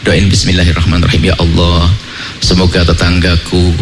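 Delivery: put-on father soothing the child, then praying; gentle father. Calm father leads child's prayer.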